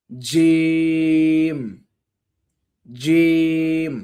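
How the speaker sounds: জিম very slow and heavy, fading to a whisper; a fading drill of জিম; repeat after me. Shown as a demonstration. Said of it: "আমার সাথে সাথে পড়েন".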